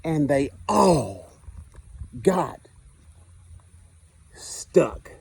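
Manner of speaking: lively picture-book narration, dwelling on the punchlines; reading to children. Acted. Teacher reading Stuck to the class, storytime voice. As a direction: read it like storytime on the carpet.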